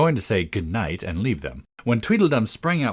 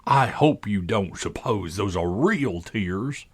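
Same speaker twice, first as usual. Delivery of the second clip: slow, drawn-out scornful character voice; lofty contempt, slowly delivered; great contempt; slower than usual. A narrator's reading. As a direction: sneer at the tears, dragging the words. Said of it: "in a tone of great contempt".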